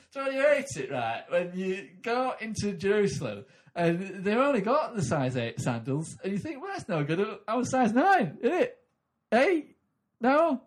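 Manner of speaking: loud, high, hesitant club comic; hammed-up pub joke teller fumbling the set; bad northern comedian. Comic parodies a struggling club comedian, loud and high. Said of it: "comedian from the north".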